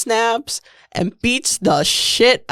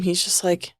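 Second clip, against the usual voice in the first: usual speaking voice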